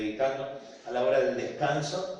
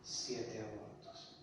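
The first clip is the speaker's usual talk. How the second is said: hushed; 4 semitones lower, 13 dB quieter, much slower than usual. Retold or imitated. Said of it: "Y me dijo".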